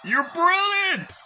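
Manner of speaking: slow, high, loud delight; high excited shout. Acted. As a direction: stretch it out in joy. Voicing game character.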